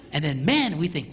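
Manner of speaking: ordinary delivery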